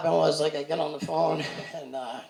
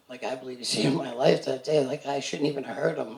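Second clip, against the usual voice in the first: ordinary talk, nothing marked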